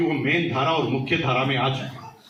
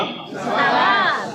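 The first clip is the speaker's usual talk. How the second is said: very high, long-drawn shout; shouted praise; 11 semitones higher, much slower than usual. Retold or imitated. Speaker replaying his mentor's praise for the crowd, shouted cheer. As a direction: stretch the cheer out at full pitch.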